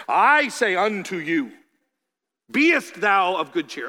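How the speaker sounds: slow, loud, grand proclamation; mock King James Jesus. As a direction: intone it like old scripture. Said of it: "Jesus might say".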